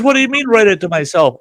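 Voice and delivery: high, loud fast disbelief; baffled cop. Retold or imitated. The cop hearing he should ticket himself, loud baffled disbelief. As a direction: fire it back, baffled.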